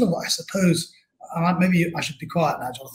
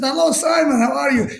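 high, loud, eager hello; enthusiastic greeting; 9 semitones higher, 5 dB louder than usual. Retold or imitated. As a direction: greet him like an overjoyed American. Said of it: "And he said".

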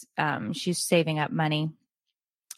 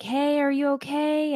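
concerned stranger; 9 semitones higher than usual. Retold or imitated. Kind stranger voiced in story.

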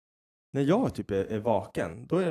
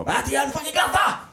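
much higher and louder, exasperated shout; fed-up yell; 5 dB louder than usual. Retold or imitated. Dad retelling snapping at his screaming son, exasperated shout. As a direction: hurl the words as you give in. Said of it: "slänger den i ansiktet på".

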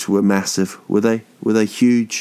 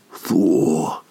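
Thor lower, drawn out and longing; lustful sigh; 6 semitones lower than usual. Pretend. Mock swooning at an attractive person, low drawn-out sigh. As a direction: breathe Thor like a crush walked past. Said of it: "when someone sees someone they're attracted to".

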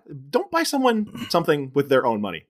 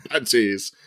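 mock-creepy low delivery; playful, low word-relishing; teasing low voice; 5 semitones lower than usual. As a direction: drop low for a cringey word.

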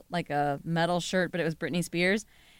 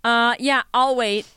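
louder, higher, lightly hammed-up customer; entitled customer; 3 semitones higher, 6 dB louder than usual. At the Lowe's service desk, playing a pushy fake employee, mock-demanding. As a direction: act pushy, like you're owed service.

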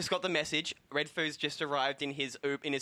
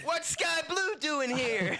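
slow, high shouted outrage; shocked shout; 9 semitones higher, slower than usual. Acted. [name] outraged as rival walks in, slow high shout. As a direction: yell it in disbelief, drawn out.

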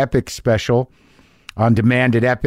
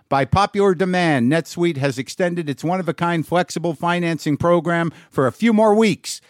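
upbeat sponsor read, higher pitch; 6 semitones higher than usual. Acted. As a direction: read it like a podcast ad. Reads a sponsor ad.

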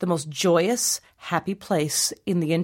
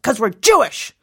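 mock exasperated, raised voice; exasperated mother; 5 semitones higher, 5 dB louder than usual. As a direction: imitate a mother putting her foot down, louder. Mother snaps back at child.

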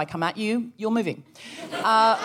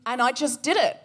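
ordinary talk, nothing marked

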